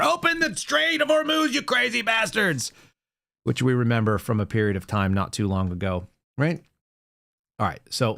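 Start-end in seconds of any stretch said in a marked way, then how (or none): 0.0-2.7 much higher, strained over-the-top ranting voice; hammed-up angry leader shouting at rivals; mock presidential rant. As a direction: bellow it like a furious all-caps post. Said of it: "he types like this".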